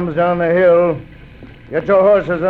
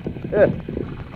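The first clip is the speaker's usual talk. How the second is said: breathy gloating chuckle; 3 semitones higher, 8 dB quieter than usual. Acted. Bandit gloats after scheming.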